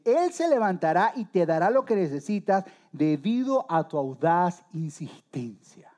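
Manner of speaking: slow, emphatic verse reading; measured preacher's reading with drawn-out words; scripture reading. Acted. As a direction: read it out deliberately, word by word. Reads the Bible verse aloud.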